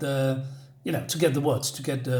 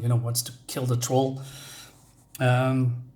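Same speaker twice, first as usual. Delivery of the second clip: ordinary delivery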